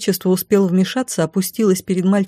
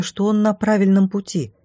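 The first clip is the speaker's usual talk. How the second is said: plain reading voice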